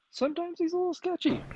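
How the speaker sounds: very high, quick sing-out; squeaky, hurried aside; high-pitched hedging. Pretend. Voicing doubt about absent friend.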